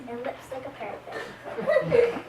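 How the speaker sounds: slow, giggling through the line; can't keep a straight face; drawn-out words broken by laughter; laughing. Real, not acted. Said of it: "I'm a person who, like, laughs a lot".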